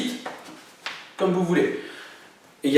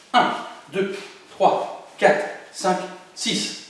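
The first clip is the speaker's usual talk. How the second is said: very fast counting; 4 semitones higher, much slower than usual. Shown as a demonstration. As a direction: rattle off the count, quick and clipped. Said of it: "Et encore plus vite". (comptage très rapide)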